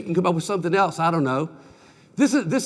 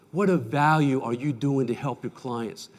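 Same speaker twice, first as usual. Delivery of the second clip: usual speaking voice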